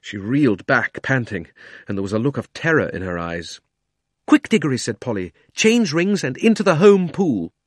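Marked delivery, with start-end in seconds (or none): 4.3-4.8 louder, higher urgent call. Acted. Polly urging Diggory to escape. 5.5-7.5 high, loud, slow urgency; urgent instruction. Acted. Polly telling Diggory to change rings, urgent.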